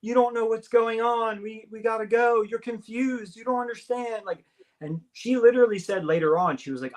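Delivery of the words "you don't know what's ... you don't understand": higher, frantic urging; urgent yelling. Retold or imitated. The storyteller urges his confused girlfriend to flee, in frantic yelling. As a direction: plead with rising panic to get out. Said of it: "yelling at her".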